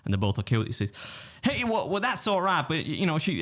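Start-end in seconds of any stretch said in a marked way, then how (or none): 1.4-3.4 high-pitched mock Texan accent; Texas voice. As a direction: imitate the meddling customer in a high Texan twang. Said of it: "this is my Texas voice".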